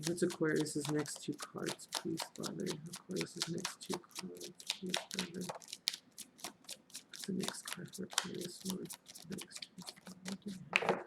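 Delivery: hushed, whispered plea to Father; whispered prayer. Real, not acted. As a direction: murmur it like a private prayer. Asks spirit for next cards.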